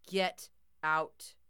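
loud, cold command. A narrator's reading. Grandpa's bear warns intruder, loud cold command. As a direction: push the warning harder. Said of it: "louder this time".

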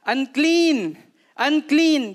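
loud, high cry of "Unclean!"; shouting the leper's warning from the road. Retold or imitated. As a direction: call out a warning to the crowd. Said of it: "Sisigaw ka ng".

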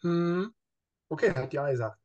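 hesitant, unconvinced hum and okay; half-hearted approval; reluctant undertone. Acted. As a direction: answer politely while meaning otherwise. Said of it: "so einen Unterton in der Stimme".

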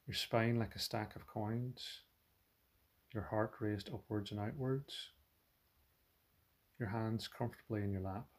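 soft, unhurried meditation voice with long pauses; guided meditation. Acted. Teacher settling listeners into posture and body awareness, soft guided meditation. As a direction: guide them gently and quietly into settling.

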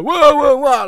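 high, loud hyped-up whoa; excited guy. Retold or imitated. Podcaster mocking his own hyped-up self, loud and excited. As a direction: ham up an over-excited host. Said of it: "this sort of excited guy".

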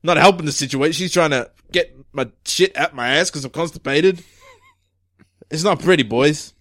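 put-on gruff-chief delivery; irritable police chief. Chief grumbling to his detectives about being stuck, irritable.

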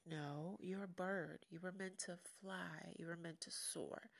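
soft, hushed motherly reassurance; quiet mother coaxing her chick; mama bird. Retold or imitated. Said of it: "the mama bird was like".

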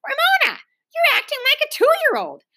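high, sharp parental reprimand; scolding. A narrator's reading. Mother to daughter kicking on floor, scolding. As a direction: reprimand her in a high, exasperated voice.

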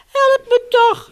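high, plaintive call; a little dog calling out unhappily; sad cry for help. A narrator's reading. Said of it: "riep hij verdrietig".

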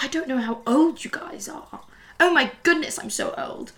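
mock-weary, airy half-whisper; hushed put-on old-lady lament; imitating the professor. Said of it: "she'd be like".

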